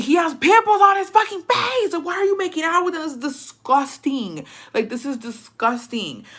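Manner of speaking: high-pitched, appalled shout easing in volume; disgusted outburst. Retold or imitated. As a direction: shriek in disgust, then drag out "disgusting". Retold disgusted outburst at friends kissing.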